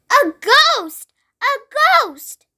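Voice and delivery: high, swooping cries of alarm; panicked yelling. A narrator's reading. Townspeople seeing an empty dressing gown float past, panicked yell. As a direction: cry out in panic. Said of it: "a panic with everybody yelling".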